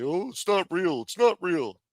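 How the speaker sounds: half-hearted mock dismissal; mocking deniers. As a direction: lightly parody the doubters brushing it off. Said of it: "and say".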